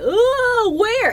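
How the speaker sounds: high, loud mock wail; retold panic. Retelling own reaction to her scream, hammed-up panic. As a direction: yelp it in comic alarm. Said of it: "I was in a panic".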